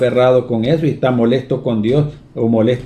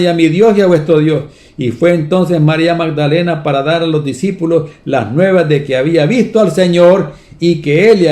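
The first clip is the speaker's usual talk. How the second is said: raised, fervent storytelling preach; preaching the story; 4 semitones higher, 5 dB louder than usual. Acted. Preacher retelling Mary at the tomb, fervent. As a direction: carry the congregation through the tomb scene.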